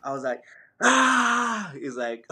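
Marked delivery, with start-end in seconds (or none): 0.8-1.7 sudden high wordless yell; retold scream. Runner to his pacer mid-race, releasing built-up tension with a scream. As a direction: let out a pent-up scream, pitched way up. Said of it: "I just have to let out a big scream".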